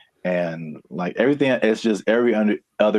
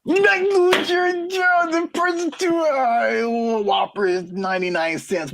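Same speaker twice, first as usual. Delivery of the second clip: very high, slow cartoon voice; squeaky mock voice; slower than usual. Cohost mocks job losses in a very high cartoon voice. Mocking voice about lost jobs.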